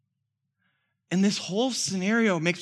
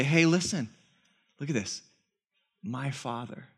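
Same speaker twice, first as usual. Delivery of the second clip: very gentle; 4 semitones lower than usual. Retold or imitated. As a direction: coax him gently like a servant. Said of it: "very gentle".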